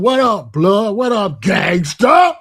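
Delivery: loud, slow street shout-out; gangster greeting. Retold or imitated. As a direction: call out to your homies across the block. Acting out old gangster greeting.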